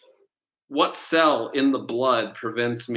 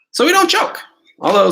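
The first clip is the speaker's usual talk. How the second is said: squealing laugh; 7 dB louder, faster than usual. Real, not acted. Laughing at own choking joke.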